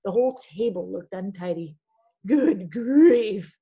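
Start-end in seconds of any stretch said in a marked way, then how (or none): 2.3-3.6 louder, exasperated good grief; fed up at the mess; a teacher's annoyed outburst; unhappy exclaim. Acted. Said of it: "in a very unhappy voice".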